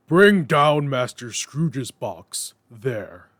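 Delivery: loud, slow schoolmaster's cry; terrible voice crying. A narrator's reading. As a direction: bellow down the hall. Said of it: "A terrible voice in the hall cried".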